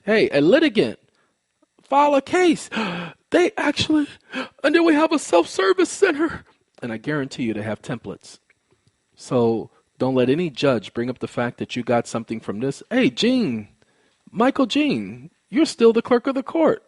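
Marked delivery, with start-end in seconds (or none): 0.0-6.4 worked-up shout to the viewers; excited. Real, not acted. Excited calling out to court. 12.9-16.8 calling out the court clerk by name. Real, not acted.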